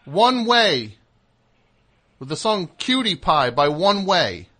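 loud, high-pitched elation; real excited crowing; excited discovery. Real, not acted. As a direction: announce the find at a shout. Announces the long-sought song found.

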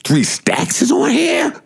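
high, slow, stunned squeal; amazed. Retold or imitated. Friend discovering [name] on the album, squealing in shock. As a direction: sound floored by the surprise. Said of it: "He said".

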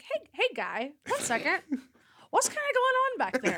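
high, tentative mock query; gently interrupt, pitch climbing high; politely puzzled, rising into a squeak; tentative checking in.

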